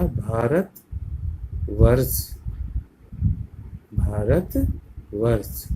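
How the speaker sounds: slow, careful pronunciation for class; Bharat वर्ष drawn out for pupils to copy; slow demo. Shown as a demonstration. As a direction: say Bharat वर्ष slowly and clearly. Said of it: "इस तरह से हम दूसरे शब्द को पढ़ेंगे".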